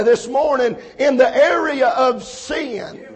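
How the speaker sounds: emphatic preaching in punched, repeated phrases; fervent preaching. Real, not acted. Preacher warning young people and adults about sin, fervent preaching. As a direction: drive each phrase home to the congregation.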